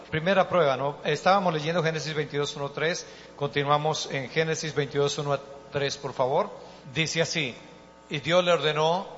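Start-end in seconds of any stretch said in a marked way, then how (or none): none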